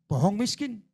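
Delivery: loud, high, driving preacher's rebuke; fiery preaching. Acted. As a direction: hammer the congregation with holy anger. Preaching at full force. (khotbah berapi-api dengan suara keras dan tinggi)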